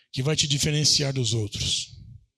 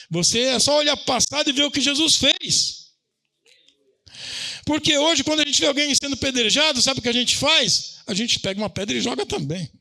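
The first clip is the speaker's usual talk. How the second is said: fiery preaching; 8 semitones higher than usual. Acted. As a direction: drive the point home to the congregation. Preaching at full force. (pregação inflamada, aguda e forte)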